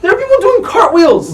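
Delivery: thrilled outburst at a wild sight; excited exclamation. Real, not acted. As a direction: exclaim it in high-pitched disbelief. Amazed reaction to celebrating coaches.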